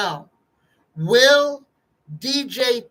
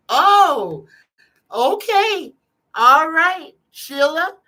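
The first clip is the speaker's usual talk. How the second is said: much higher and louder, startled oh, okay; surprised exclamation; 6 semitones higher, 6 dB louder, slower than usual. Real, not acted. A reader reacts to Sheila's jelly card with loud, high surprise. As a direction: caught off guard by the card, then a loud all right.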